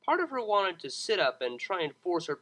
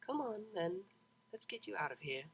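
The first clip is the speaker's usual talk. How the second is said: much softer, calm remembered voice; serene voice; 5 semitones higher, 9 dB quieter than usual. A narrator's reading. Half-remembered helper guiding drunk Vinyl, serene and soft. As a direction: soothe her out of the bar, softly. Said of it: "an oddly serene voice".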